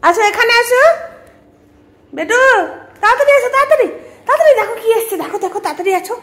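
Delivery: high, loud excited calling. Real, not acted. Mother calling her pet to hurry over, high and loud.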